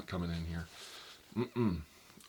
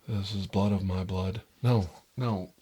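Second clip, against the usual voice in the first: loud blurted line; 9 dB louder than usual. Acted. Second try at ear whisper.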